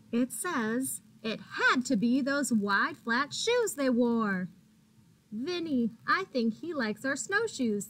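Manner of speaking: bright, lively picture-book reading; animated storybook narration; reading to children. A narrator's reading. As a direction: read it like storytime for little ones. Reads picture book to kids.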